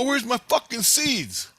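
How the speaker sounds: high-pitched retold outburst; angry demand. Intruder confronts scientist over stolen seeds, angry demand. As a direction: demand your property back.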